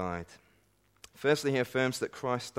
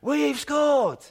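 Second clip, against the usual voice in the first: much higher, loud joyful cheer; nation's shout; 9 dB louder than usual. Retold or imitated. Preacher imitating England fans cheering a goal. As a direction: belt it like all England celebrating. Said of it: "the whole nation shouts".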